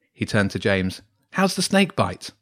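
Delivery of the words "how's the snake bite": lightly raised, eager schoolboy voice; Wilf's eager voice. Acted. Boy telling friends about a strange mark, eagerly. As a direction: chatter on like an excited boy.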